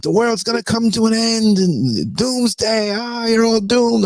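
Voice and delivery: high, loud, wailing doom prophet; doomsday street preacher. Retold or imitated. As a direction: wail it like a street-corner doomsayer. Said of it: "like the guy in, on Main Street".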